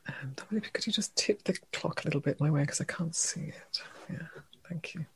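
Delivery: very soft, half-whispered polite request; hushed aside. Real, not acted. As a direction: drop to a near-whisper for a private favour. Quiet aside to a helper.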